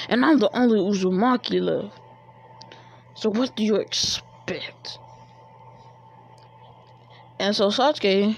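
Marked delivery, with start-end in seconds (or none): 3.1-5.0 breathless taunting whisper; menacing whisper. Acted. Naruto whispering a threat to Sasuke, unvoiced.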